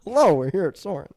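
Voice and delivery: loud, swooping ride-host hello; booming theme-park welcome impression; Putty. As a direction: announce the ride like its intro host. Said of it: "be his voice".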